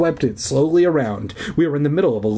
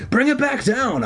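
urgent cry; 13 semitones higher than usual. A narrator's reading. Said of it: "Zeke cried to me".

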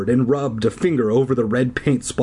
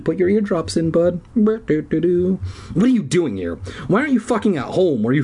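talking to a pet; 6 semitones higher than usual. Real, not acted.

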